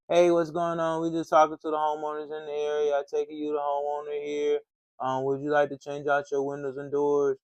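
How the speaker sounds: flat, dull, routine pitch; boring salesman. Shown as a demonstration. Salesman showing the dull pitch that sounds like everybody, flat. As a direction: drone it like every other rep. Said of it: "I sound like everybody else".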